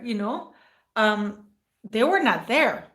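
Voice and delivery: ordinary talk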